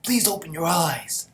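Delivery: high, loud, slow cry of guilt; hammed-up grieving soldier, slowed down; anguished plea. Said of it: "Kanto's like".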